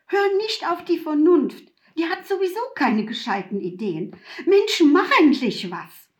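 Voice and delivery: loud, high, rushing shout; urgent shout. A narrator's reading. Mutwillen yelling at Laura to act, loud urgent shout. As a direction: yell at her to finally act. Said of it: "rief Mutwillen".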